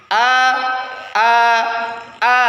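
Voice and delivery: teacher snapping out the hamzah A as a sudden glottal catch; hamzah demo. Shown as a demonstration. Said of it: "cukup gini saja pengucapan hamzah".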